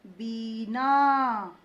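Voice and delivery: बीना with a slow, drawn-out long ई. Shown as a demonstration. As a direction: stretch the बी of बीना. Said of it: "बड़ी की मात्रा का प्रयोग करना है".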